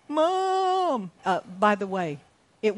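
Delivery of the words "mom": put-on high calling-out child; mock child's cry for mom, much higher. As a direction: call out for mom like a hurt kid. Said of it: "At some point in the evening".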